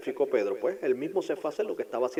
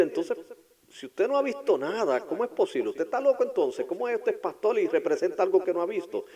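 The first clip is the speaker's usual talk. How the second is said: higher, incredulous challenge; mock sceptical listener; 7 semitones higher, 4 dB louder than usual. A sceptic challenging the pastor, higher and incredulous. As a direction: play the doubter raising his voice. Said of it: "usted me dirá".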